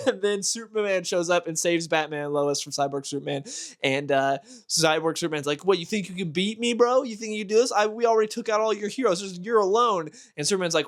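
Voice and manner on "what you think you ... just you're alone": higher, cocky villain taunt. Retold or imitated. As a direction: sneer at the hero like a smug bully. Cyborg Superman taunts Superman.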